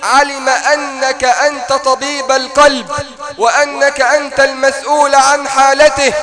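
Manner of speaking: loud, high impassioned sermon cry; preaching at full force. Acted. Preacher to congregation telling the dying youth's story, impassioned loud preaching. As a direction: pour it all out to the crowd, voice soaring.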